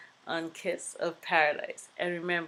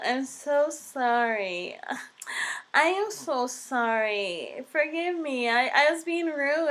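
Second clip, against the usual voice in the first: high, gushing fake apology; mock apologetic woman; 7 semitones higher, 4 dB louder, slower than usual. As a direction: ham up a flustered stranger saying sorry. Woman apologizing, mocked by teller.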